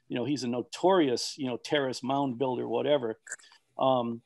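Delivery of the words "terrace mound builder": ordinary talk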